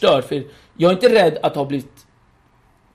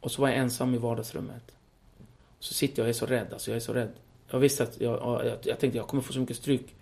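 much softer, hushed retelling; hushed fear; 4 semitones lower, 12 dB quieter than usual. Real, not acted. Son recalling waiting alone, much softer. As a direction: tell it low as if still afraid. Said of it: "fear memory, hushed".

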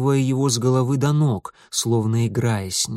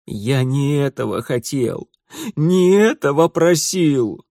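sobbing despair; 3 dB louder than usual. Acted. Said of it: "громко зарыдал".

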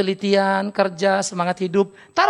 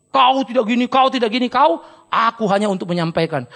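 louder, pointing-finger voice of a judgmental preacher; mock accuser; 3 semitones higher, 6 dB louder than usual. Hypocrite scolding others while doing nothing, loud accusing voice. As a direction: wag your finger at everyone.

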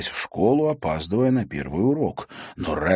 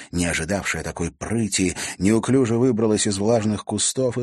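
ordinary delivery

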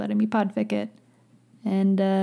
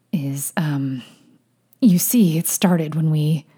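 halting, faltering explanation; trying to sound collected; stalling for composure; 3 semitones lower than usual. A narrator's reading. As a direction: fumble for words, pausing between bits. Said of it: "making a visible attempt to collect himself".

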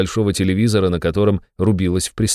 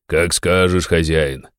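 glum; 2 semitones lower, slower than usual. A narrator's reading. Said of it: "уныло повиновался Веник".

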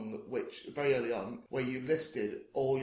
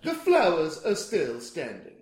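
slow film-line delivery; Bill Murray; 2 semitones higher, 7 dB louder, much slower than usual. Shown as a demonstration. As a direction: drag the quote out slowly. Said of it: "say it like it is in the film".

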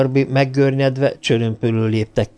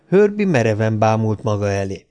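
ordinary delivery, nothing marked

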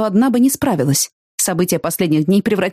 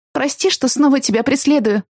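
trembling voice; 6 semitones higher than usual. A narrator's reading. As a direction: voice quivering with emotion. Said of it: "Мой голос непривычно вибрировал".

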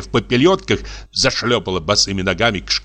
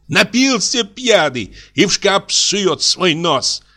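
high, slow, angry cry; angry shout; 10 semitones higher, slower than usual. A narrator's reading. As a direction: snap at the drunk. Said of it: "крикнула Дашенька".

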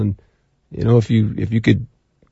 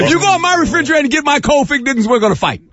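mock threat; 12 semitones higher, faster than usual. Joking threat over Fig Newtons.

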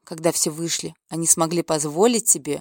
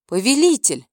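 raised call to the lord; 4 semitones higher than usual. Acted. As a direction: call out to get attention. Calling out to the king.

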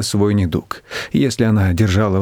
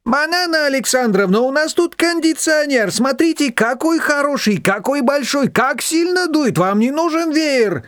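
overwrought, flustered. A narrator's reading. Shushana wheedling the guest's fan away, overwrought. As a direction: fuss and gush in a high, nervy voice. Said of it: "Шушана уже слишком разнервничалась".

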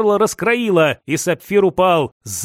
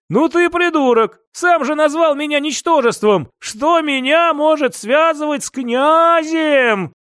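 mocking; 7 semitones higher than usual. Captive jeers at Sapphire, high mocking scorn. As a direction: laugh at him with contempt. Captive mocks his tormentor.

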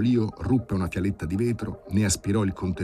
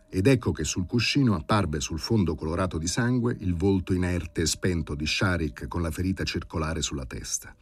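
ordinary delivery

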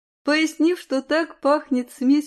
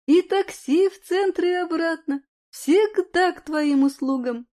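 high, eager sales-pitch friend; enthusiastic friend; 6 semitones higher, 3 dB louder than usual. Acted. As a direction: sell it with enthusiasm. Lyudmila lists hotel comforts.

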